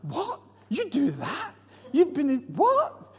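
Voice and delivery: high-pitched incredulous questions; mock shock. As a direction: act scandalised, voice shooting up. Acts shocked reaction to confessions.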